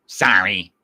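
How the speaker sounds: very loud, pointed sorry; mock apology. Standing behind a shopper in the checkout line, loud mock apology. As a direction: blast it at the person in front.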